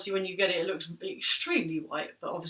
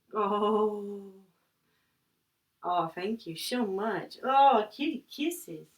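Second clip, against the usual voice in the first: slow cooing to cat; much slower than usual. Real, not acted.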